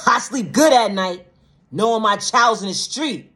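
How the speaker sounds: high, loud mimicry of a callous boast; mocking quote. Mimicking a parent whose child's in the street, mockingly. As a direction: parrot it back incredulously.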